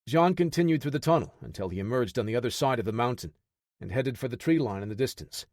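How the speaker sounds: plain reading voice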